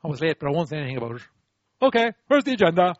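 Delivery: rushed mutter turning into brisk business; brushing it off. Acted. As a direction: hurry past the admission, then pipe up briskly. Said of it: "Instead of".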